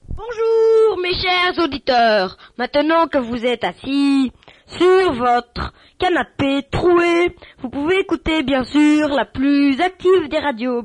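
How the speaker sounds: host welcoming listeners, hammed-up announcer voice; radio announcer. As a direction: sell the station like a cheesy DJ.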